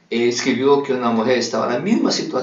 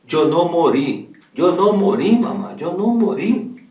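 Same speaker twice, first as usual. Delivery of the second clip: put-on higher, pleading young daughter's voice; daughter's spirit gently pleading with her grieving mother; dead daughter pleading; 6 semitones higher than usual. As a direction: beg your mother to stop crying, from beyond. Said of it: "Su hija dijo".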